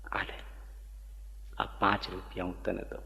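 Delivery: tender comfort. Retold or imitated. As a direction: hush down and console her gently. Surajba gives coins lovingly.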